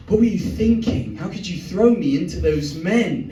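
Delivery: put-on high, scolding English accent. Angry English girlfriend scolding him, in an English accent. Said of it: "she's English".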